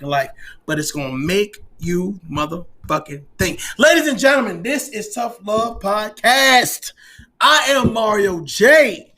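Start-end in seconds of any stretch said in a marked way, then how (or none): 3.8-9.1 slow, loud announcer sign-off; podcast sign-off. Real, not acted. Host signing off his podcast, slow and announcer-like. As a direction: announce the show grandly. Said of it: "show sign-off".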